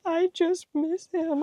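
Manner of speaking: put-on high, slow, tearful little girl voice; imitated tearful child. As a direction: sound like a kid about to cry. Imitating crying daughter missing dad.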